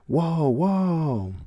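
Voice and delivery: high, loud mock crowd gasp; awed crowd. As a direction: play the whole crowd going wild. Crowd gasps at the prize.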